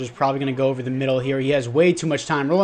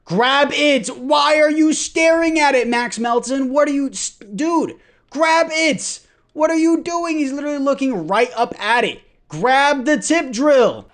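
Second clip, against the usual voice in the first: frustrated yelling; 11 semitones higher, 5 dB louder than usual. Real, not acted. Gamer screaming at Max Melton to grab the ball, exasperated. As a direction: beg the player to catch it, outraged. Defender staring at tipped ball.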